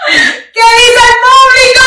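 voz de animador, alta y estirada, casi cantada; imitando a un presentador. Retold or imitated. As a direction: animar al público como presentador de tele, despacio y alto. (como animadora de televisión, alargando las palabras)

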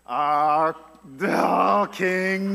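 lightly voiced fawning courtier; a hint of flattery toward the king; mock proud Haman. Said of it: "Haman so proud of himself".

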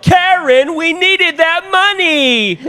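high, loud movie-quote yell; yelling the Karen line. Retold or imitated. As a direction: belt it out like the film scene. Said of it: "we like to yell the Karen lines".

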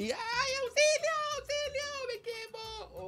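high-pitched mock panic, yelling for help; mock cry for help. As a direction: squeal it like someone trapped in a fire. Imitando gritos de auxilio en incendio. (grito de auxilio fingido, muy agudo)

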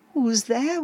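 ordinary delivery, nothing marked

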